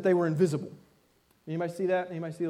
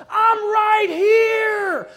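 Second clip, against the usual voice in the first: much higher, much louder, slow, sobbing shriek; crying scream; 10 dB louder, much slower than usual. Retold or imitated. Terrified girl screaming to family who won't see her, sobbing shout. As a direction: scream it through tears in full panic. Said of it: "she's screaming at the top of her lungs".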